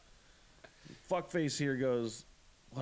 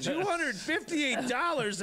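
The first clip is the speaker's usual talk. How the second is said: outraged disbelief; 12 semitones higher, 3 dB louder, slower than usual. Retold or imitated. Outraged at ticket amount.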